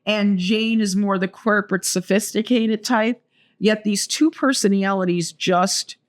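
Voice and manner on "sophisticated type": ordinary talk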